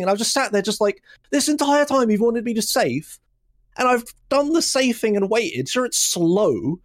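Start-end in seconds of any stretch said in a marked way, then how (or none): 1.3-6.8 higher-pitched retold indignation; exasperated protest. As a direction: protest in a raised pitch, stretching the last words. Acted exasperated retort to instructor.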